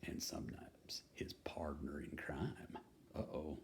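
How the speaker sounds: hushed whisper; conspiratorial whisper. Acted. Reading to kids, whispering about partners in crime. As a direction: whisper like sharing a naughty secret.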